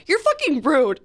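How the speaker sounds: lance-le avec une colère rejouée, fucking appuyé; angry retort. Retold or imitated. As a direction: stress on fucking, a retold angry snap. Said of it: "I was so angry".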